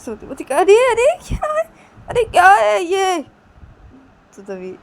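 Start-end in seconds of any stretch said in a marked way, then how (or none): 0.4-3.2 high, loud crying protest; tearful cry. Acted. Shruti cries out as the boy hits her. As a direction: cry out while being hit.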